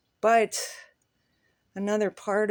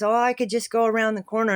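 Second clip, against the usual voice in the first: put-on offhand old man; 4 semitones higher, 6 dB louder, faster than usual. Old man's offhand reply.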